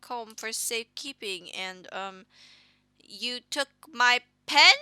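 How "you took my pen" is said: loud shocked question. Acted. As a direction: demand it in shock. Shocked at the pen theft.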